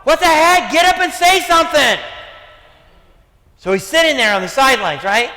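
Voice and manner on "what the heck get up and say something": high, loud exasperated yell; frustrated outburst. Retold or imitated. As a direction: re-enact fed-up yelling from the sidelines. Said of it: "I would be so frustrated".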